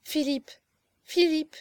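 anxious, pleading woman's call; distressed young woman. A narrator's reading. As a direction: call the name with rising urgency. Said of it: "reprit la pauvre jeune femme".

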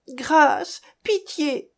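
high-pitched begging; pleading for mercy. A narrator's reading. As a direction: beg for mercy, voice climbing. Tortured man begs for mercy. (supplication, voix qui monte)

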